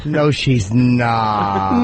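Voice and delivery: very drawn-out mocking mimicry; slow parody of the caller's protest; imitating her voice. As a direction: stretch every word slowly, mimicking the girl. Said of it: "I gotta get that voice down".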